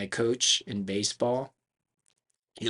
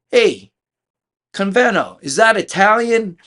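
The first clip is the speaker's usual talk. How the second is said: high, loud imitated challenge; loud questioning; 11 semitones higher, 13 dB louder than usual. Banquet guy quizzing Sal about his name, loud. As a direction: call out the name and demand an answer.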